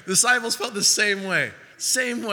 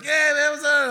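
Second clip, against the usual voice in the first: high, loud greeting voice; cheery greeter; 8 semitones higher, 6 dB louder than usual. Retold or imitated. Churchgoer greets him on Sunday morning, high and loud. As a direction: bounce it up like a friendly churchgoer.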